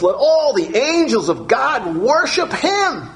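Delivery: lifted, slowed voice of God. Acted. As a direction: announce it as God decreeing to the angels. Quoting God in the sermon.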